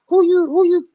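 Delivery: hammed-up angry father barking back; dad yelling. Daughter imitates her yelling dad's comeback, mock-loud. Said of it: "he's a yeller".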